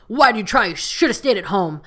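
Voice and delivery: loud, scolding border guard; mock angry guard. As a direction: bark at her like a rude guard.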